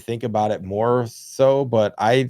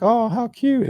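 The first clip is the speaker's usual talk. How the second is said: much higher, imitating a cooing wife; 14 semitones higher, slower than usual. Wife spotting a cute dog, high imitated coo.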